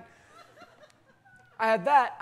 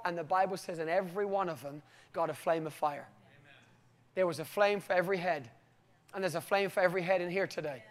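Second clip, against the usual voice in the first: softer, lowered, sincere preaching; earnest preacher's appeal; 3 semitones lower, 4 dB quieter than usual. Real, not acted. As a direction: bring it down to a gentle personal appeal. Turns to address the congregation directly.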